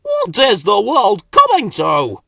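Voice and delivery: loud high shout; exasperated outburst. A narrator's reading. Slowcoach lamenting to himself, loud exasperated shout. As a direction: throw up your hands.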